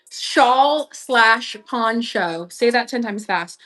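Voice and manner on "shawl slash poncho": "Shawl slash poncho" very slow, each word separated; careful enunciation. Real, not acted. Repeating a tongue twister, "Shawl slash poncho" slowly enunciated. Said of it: "really enunciate my words".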